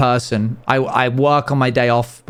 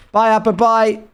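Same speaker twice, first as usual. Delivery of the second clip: cutesy goodbye; 7 semitones higher than usual. Real, not acted. Saying bye to pet.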